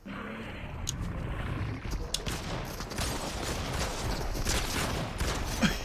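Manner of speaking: breathless high-pitched laughter; high wheezing laugh. Real, not acted. Laughing at teammate.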